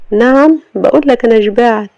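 wife rebuking the husband for banging on her door; indignant Sama. Acted. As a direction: snap back at the rude husband.

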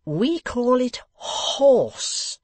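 host patiently correcting the guest; with forbearance. A narrator's reading. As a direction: correct him with weary patience. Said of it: "with forbearance".

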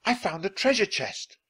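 high-pitched announcement of a find; excited cry. A narrator's reading. Jack tells Annie of the chest, excited and high. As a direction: exclaim it with excitement. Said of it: "cried Jack".

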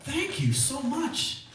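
high, slow grateful gush; overjoyed thanks to the stranger. Retold or imitated. Grateful for free ticket.

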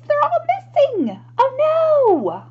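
high, loud cry of dismay; dismayed alarm. Acted. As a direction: play up the shock for little listeners. Peddler finds caps missing.

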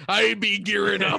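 loud hammed-up pirate. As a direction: growl it like a pirate. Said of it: "You sound a bit like a pirate".

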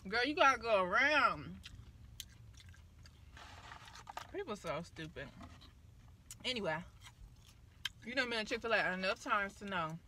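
talking between bites; chewing while talking. Real, not acted. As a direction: mouth partly full. Seen 2: Hand brings food to mouth, then jaw works while she talks.

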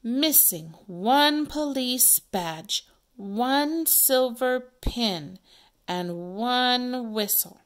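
slow, deliberate sign reading; reading a notice aloud. Acted. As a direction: read each item out slowly, with weight.

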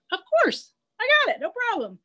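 high, loud, quick crow reply; high-pitched, obliging little bird voice; eager crow. Acted. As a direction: chirp back an eager yes as the crow. Voicing eager crow's reply.

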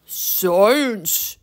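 drawn-out gravelly troll answer; knowing troll growl; Torque. A narrator's reading. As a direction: say it proudly in a rough troll voice. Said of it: "deep and gravelly voice".

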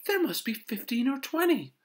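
very high, loud wonder; amazed exclamation. A narrator's reading. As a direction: marvel out loud at how many. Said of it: "Missus Huggins exclaimed".

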